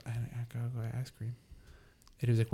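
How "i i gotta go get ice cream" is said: sleepy mumble. Retold or imitated. Sleepwalking kid quoted.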